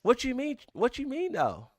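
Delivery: very high-pitched incredulous protest; mock disbelief. Streamer protesting when chat said no, in mock disbelief. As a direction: squeak up in playful outrage.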